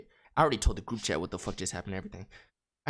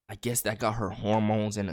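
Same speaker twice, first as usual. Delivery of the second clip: plain reading voice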